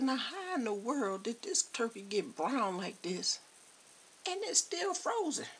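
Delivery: high, rising disbelief; incredulous. Retold or imitated. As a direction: squeal at the still-frozen turkey. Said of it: "I said".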